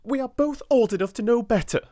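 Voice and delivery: restrained fire. A narrator's reading. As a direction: bite back the urge to strike. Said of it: "she said with some fire".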